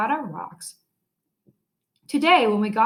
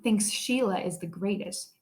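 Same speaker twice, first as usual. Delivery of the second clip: plain reading voice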